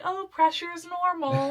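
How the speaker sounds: high, slow dismissive nurse; breezy nurse voice. Retold or imitated. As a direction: brush it off lightly. Nurse dismissing her, retold.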